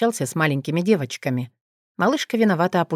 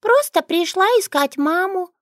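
put-on little girl voice; 4 dB louder than usual.

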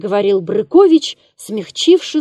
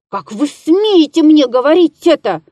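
hissed in anger; 5 semitones higher, 5 dB louder than usual. A narrator's reading. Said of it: "прошипел он".

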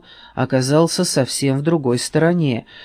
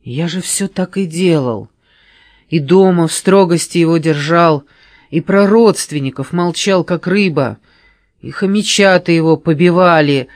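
justifying himself; 4 semitones higher, 6 dB louder than usual. A narrator's reading. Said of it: "попытался оправдаться Грыз".

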